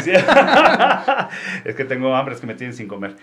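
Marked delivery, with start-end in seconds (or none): 0.2-1.6 embarrassed laugh. Real, not acted. Stomach growls on air, embarrassed.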